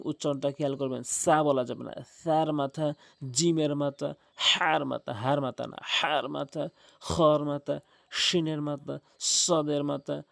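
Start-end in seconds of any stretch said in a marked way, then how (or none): none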